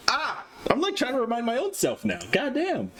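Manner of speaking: high-pitched exasperated squeal; flustered. Real, not acted. Streamer reminding own self live, flustered. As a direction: scold yourself in a high, flustered voice. Flustered at forgetting what she said.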